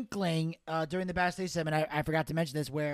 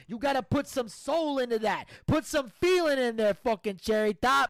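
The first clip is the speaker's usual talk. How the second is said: higher-pitched, emphatic Italian-American mobster; exasperated mob Don; 6 semitones higher, 3 dB louder than usual. Acted. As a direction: lecture like an irritated mafia boss. Plays the Don.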